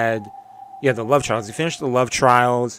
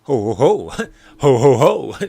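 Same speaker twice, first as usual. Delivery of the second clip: lightly voiced Santa ho ho ho, a bit louder; a playful hint of Santa Claus; Santa laugh; 6 dB louder than usual. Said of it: "It's not Christmas yet".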